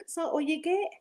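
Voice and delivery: high, squeaky outrage at the tiny measure; indignant disbelief. Retold or imitated. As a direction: feign shock at the small glass. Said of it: "So we were like".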